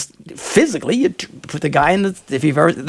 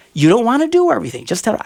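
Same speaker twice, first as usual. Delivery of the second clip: ordinary talk, nothing marked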